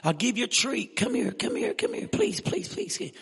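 high, pleading coax to a runaway dog. Retold or imitated. Evangelist chasing his loose dog down the hall, high begging coax. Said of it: "I'm saying".

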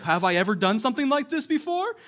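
talking donkey. Retold or imitated. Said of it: "the donkey's like".

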